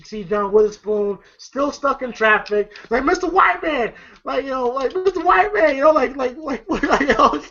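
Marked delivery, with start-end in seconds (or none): 3.0-5.8 high, desperate begging voice; pleading father. Retold or imitated. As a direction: beg a stranger, voice up high. Stuck father begging driver.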